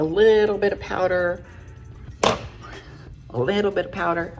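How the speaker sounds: sing-song put-on voice, repeated; funny voice. Real, not acted. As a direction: toy with the words in a silly voice. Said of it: "I don't know why I talk like that".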